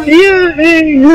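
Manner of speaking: a nervous pretend celebration; mock cheer. Streamer gives himself a scared mock cheer.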